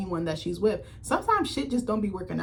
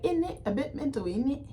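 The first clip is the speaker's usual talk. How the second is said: mock British accent; 6 semitones higher than usual. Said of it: "British people be like".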